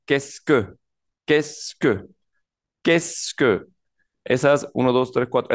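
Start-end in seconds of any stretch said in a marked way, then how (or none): 0.1-3.6 "Qu'est-ce que" sounded as two syllables, kess-kuh; read as one. Shown as a demonstration. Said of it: "se lee completo".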